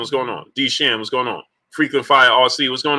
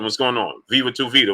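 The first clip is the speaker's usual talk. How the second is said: ordinary delivery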